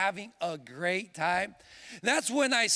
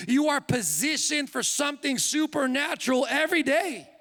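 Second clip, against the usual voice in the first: rising fervor, getting louder toward the promise; building preaching; 5 semitones higher, 3 dB louder than usual. Acted. Preacher building fervor to the church, steadily louder. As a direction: preach it up to the congregation.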